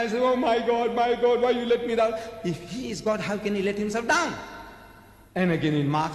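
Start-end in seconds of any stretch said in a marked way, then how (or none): 0.2-2.1 higher-pitched mock cry to God; mock dramatizing. Preacher imitating Jesus crying to God, mock dramatizing. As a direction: cry out as if putting on an act. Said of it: "dramatizing himself that he's dying now".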